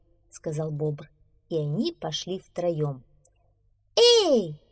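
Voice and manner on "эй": high, loud squeaky hail; little mouse. Acted. Little mouse stopping his friends, shouting. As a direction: halt them with a tiny high shout. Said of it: "остановил друзей маленький мышонок".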